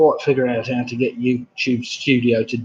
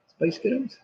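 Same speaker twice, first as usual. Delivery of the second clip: soft, higher-pitched American accent; 8 semitones higher, 8 dB quieter than usual. Shown as a demonstration. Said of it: "in an American accent".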